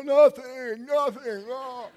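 high, loud choked "nothing"; tearful denial. Retold or imitated. Answering his wife's question while crying, high choked denial. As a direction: squeak out the denial through tears.

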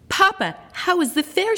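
put-on high, eager child voice; eager young girl greeting father; excited Grace. A narrator's reading. Grace calling to Papa, high and eager. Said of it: "she asked".

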